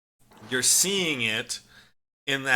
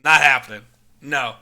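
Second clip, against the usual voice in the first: parent's loud refusal; 8 dB louder than usual. Retold or imitated. Parents refusing neighbor kids.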